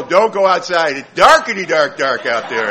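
loud, hammed-up frightened warning; mock panicked warning. Spooked husband warning wife about the dark, comic panic. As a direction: blurt it out loudly in comic panic.

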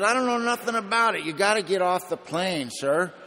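high, loud exasperated dismissal; perturbed flight attendant. Retold or imitated. Attendant insists the passenger get off the plane, loud and exasperated. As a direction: imitate an attendant brushing off a passenger's complaint. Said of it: "she's looking at me very perturbed".

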